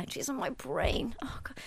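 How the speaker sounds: whispered to herself. Real, not acted. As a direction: whisper in frustration. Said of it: "I can't remember".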